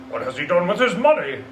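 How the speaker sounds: lightly voiced curious merchant; red-faced gentleman. Gentleman asking about the money, nosy. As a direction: ask it like a nosy businessman.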